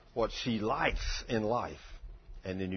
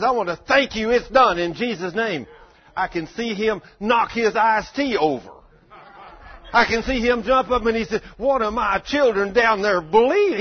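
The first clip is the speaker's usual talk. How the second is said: loud, high, impassioned preaching; a preacher acting out his prayer and God's answer, loud and high; full-force preaching; 11 semitones higher, 10 dB louder than usual.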